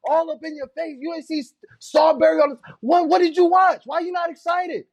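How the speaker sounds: playful indignant shouting at a calm partner. Real, not acted. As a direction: rile him up with fake outrage. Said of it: "why you not getting excited with me".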